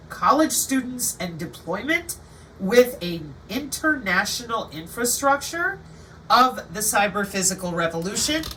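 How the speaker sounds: normal talking voice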